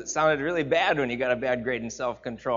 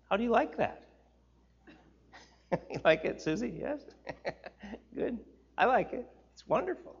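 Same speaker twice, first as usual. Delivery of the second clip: ordinary delivery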